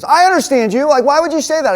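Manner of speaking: loud, high, indignant comeback; mad insistence. Retold or imitated. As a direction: imitate an angry man insisting. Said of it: "He got really mad at me".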